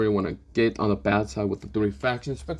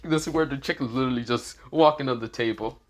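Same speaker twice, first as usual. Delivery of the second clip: plain reading voice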